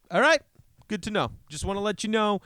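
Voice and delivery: a hint of a chill guy signing off; lightly voiced driver. Other driver calmly letting him know, lightly mimicked. Imitating calm driver, high.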